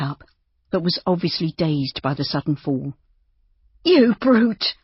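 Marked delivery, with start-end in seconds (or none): 3.8-4.7 high, loud, indignant outburst; passionate cry. A narrator's reading. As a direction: cry out in protest, pitched high. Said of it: "Hilda cried passionately".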